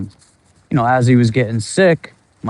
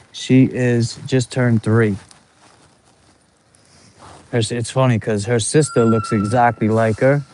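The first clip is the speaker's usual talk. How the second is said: ordinary talk, nothing marked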